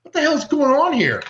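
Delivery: mock outrage. Reacting to the sample debate.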